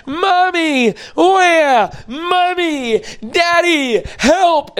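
put-on high, whimpering toddler calling for mommy and daddy; crying toddler. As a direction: play the hurt toddler crying out, pitched high. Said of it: "they cry out".

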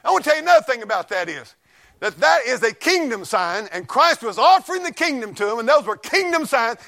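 usual speaking voice

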